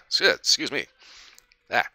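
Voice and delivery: recovering from choking on spit. Real, not acted. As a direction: still catching breath after choking. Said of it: "started choking to death with my own spit".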